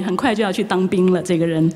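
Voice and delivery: usual speaking voice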